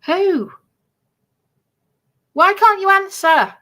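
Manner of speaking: normal talking voice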